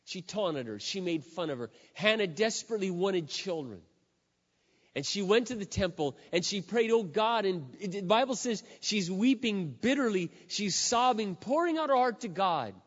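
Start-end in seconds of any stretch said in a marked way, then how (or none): 1.9-12.7 louder and higher, building fervour; preaching at full force. Acted. Preacher retelling Hannah's prayer, fervent and loud. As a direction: swell it like a pulpit climax.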